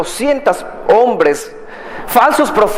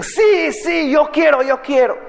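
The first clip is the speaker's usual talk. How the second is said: stubborn pleading; 8 semitones higher than usual. Acted. Stubborn young people insist.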